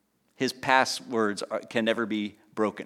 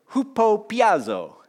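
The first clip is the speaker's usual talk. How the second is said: Italian accent; 8 semitones higher, 4 dB louder, slower than usual. Shown as a demonstration. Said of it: "Said it with an Italian accent, but that's not really it".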